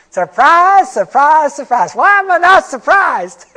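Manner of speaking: loud, high sarcastic "surprise" fading down the line. Acted. Speaker to the audience about empty charts, loud high sarcasm.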